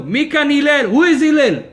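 loud, high mock yell at the window; rude shout. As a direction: bellow rudely for the rabbi. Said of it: "he screams in his window".

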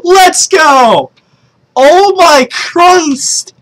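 high, loud, drawn-out hype yell; real excited yelling. Real, not acted. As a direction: shout it slowly in amazed celebration. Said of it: "so sorry guys for yelling".

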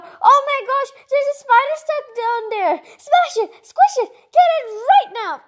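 high, loud hammed-up panic with a laugh in it; mock panic. Host spots a spider below and shrieks, mock panic. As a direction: squeal like a spider just showed up.

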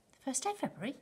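high, startled question; startled cry. A narrator's reading. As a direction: cry it out in disbelief. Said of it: "cried Missus Bucket".

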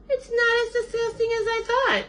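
very high, squeaky-pitched bird voice; pleasantly surprised. A narrator's reading. Bird tries the sandwich, high surprised voice. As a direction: admit it in a tiny, sky-high voice.

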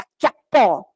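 ordinary delivery

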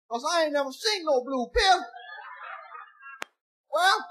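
ordinary talk

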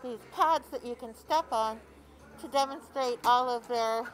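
muffled by a mask over noise. Real, not acted. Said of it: "Pardon my muffled voice".

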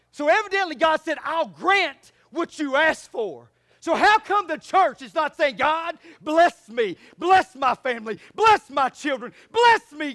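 loud building preacher cadence; rising fiery sermon; full-force preaching. Real, not acted.

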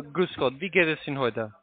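ordinary talk, nothing marked

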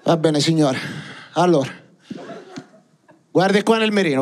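ordinary delivery

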